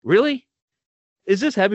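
high-pitched disbelieving retort; mock-shocked retold challenge; incredulous challenge. Confronts the yelling man in retelling.

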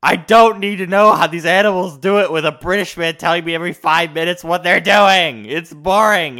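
put-on loud, whiny teen protest; retold loud complaint to mom about boring shows; complaining to mom.

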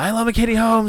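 high, excited celebrity gush; Tom Cruise impression. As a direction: gush like a smitten star. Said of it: "interview he did where he talked".

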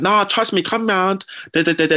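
put-on high coaxing girl voice, lightly done; insisting girl.

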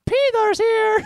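very high squeaky little vampire; tiny bat voice. Acted. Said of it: "He's like".

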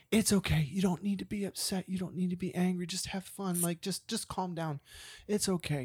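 imitating a tender, hushed reassurance; consoling. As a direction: gently talk down an upset friend. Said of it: "trying to console this woman".

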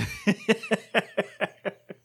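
a real high laugh that breaks out and trails off; burst of laughter. Real, not acted. Recalling the manager showing up, laughing for real.

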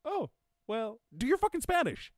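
very high, loud cartoon screech; furious owl mascot. Owl answering a learner's excuses, furious screech. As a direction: shriek the order in falsetto.

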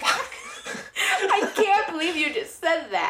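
high, loud, drawn-out protest through laughter; laughing outrage. Real, not acted. Scolding the co-host for teasing about a favorite actor, laughing outrage. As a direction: shout it in disbelief while laughing.

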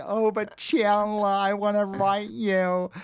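high, drawn-out wail in character; exaggerated high, clingy plea; Janice. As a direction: whine it high and very slowly, hammed up. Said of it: "trying to escape Janice".

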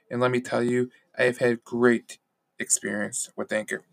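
upbeat, loud sponsor read. Acted. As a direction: sell it like a podcast ad. Podcast ad read.

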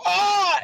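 high, sharp pretend yelp of pain; fake pain yelp. Partner faking getting tased, high pretend yelp. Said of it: "he was gonna go".

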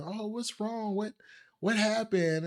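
high, soft worried voice; put-on high voice of gentle concern; concerned grandmother, imitated. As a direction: ask like a fretting grandmother. Grandmother asks crying child, retold.

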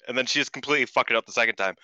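ordinary delivery

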